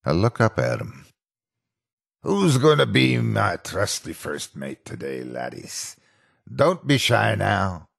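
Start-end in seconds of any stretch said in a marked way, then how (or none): 2.2-7.9 lightly voiced pirate skit. Animatronic repeating his kids' show skit, lightly voiced pirate. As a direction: recite the old stage skit. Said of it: "repeating his skit".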